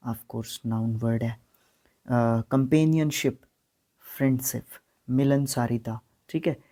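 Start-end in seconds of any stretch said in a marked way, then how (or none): none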